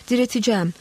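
plain reading voice

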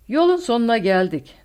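loud, high declaration of defeat; accepting defeat. A narrator's reading. Skorzen to Newman as the plane is hunted, conceding defeat. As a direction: announce that all is lost. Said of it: "mağlubiyeti kabul etmiş bir sesle".

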